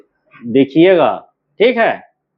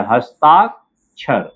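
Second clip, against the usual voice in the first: slow हस्ताक्षर, broken before the final क्षर; drawn-out हस्ताक... क्षर for writing along; syllable by syllable; 2 semitones lower, much slower than usual. Shown as a demonstration. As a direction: spell out हस्ताक्षर by sound, with a pause. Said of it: "देखिए".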